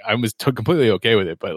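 ordinary delivery

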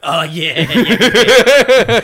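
laughing, loud Cajun drawl on the second yeah; a cheerful mock Cajun yeah, breaking into a laugh; Cajun accent. Playing Cajun raccoon accent.

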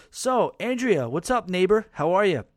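exuberant greeting. Real, not acted. Host greeting Andrea, his neighbor, loud and exuberant. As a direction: hail a neighbor with big, high energy. Host hails the listener who wrote.

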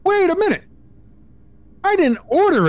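high, put-on indignant protest; mock indignation. Customer protesting to the chat agent, high mock indignation. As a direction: act outraged at a charge you never agreed to.

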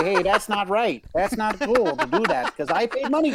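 put-on a light old-time gangster accent, almost Irish; lightly voiced mobster accent, rising on good money. Neighbourhood rep scolding someone, light gangster accent. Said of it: "that might be your best accent".

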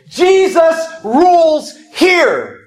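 high, very slow declaration; commanding proclamation. Acted. Believer claims the house for Jesus, commanding proclamation. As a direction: proclaim each word separately, stretched out with authority.

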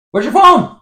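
high, loud mock-bossy yell; shouting demand. Real, not acted. Host demands the phone to settle the argument, loud and high. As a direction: bark it like the boss of the show.

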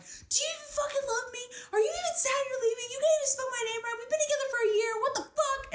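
put-on frantic high crying tirade at the boyfriend; retold crying rant. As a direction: pour out the sobbing accusations in a rush. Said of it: "I'm crying".

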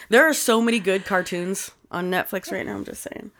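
ordinary delivery

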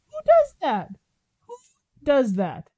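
high incredulous squeal. Real, not acted. Exasperated at ex-partner's return.

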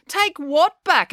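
high, drawn-out question; indignant query. A narrator's reading. Finn bickers back.

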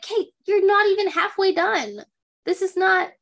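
high, emphatic exasperation; exasperated protest. Retold or imitated. Viewer talking back to a wife cleaning too soon, exasperated.

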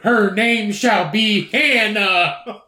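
slow, high, laughing mock proclamation; mock solemn decree. Mocking the mother announcing the baby's name, slow and giggling. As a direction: proclaim it grandly while cracking up.

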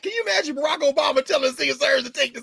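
loud, high, laughing disbelief; incredulous shout. Real, not acted. Professor loudly marveling at the double standard, laughing.

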